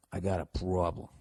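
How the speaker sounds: Boston accent on "problem"; understated Boston vowels. Shown as a demonstration. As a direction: lean into a low-key Boston accent. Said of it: "the Boston accent kinda came back".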